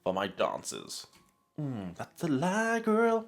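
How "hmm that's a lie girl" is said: sassy callout. Real, not acted. Calling out old self's lie.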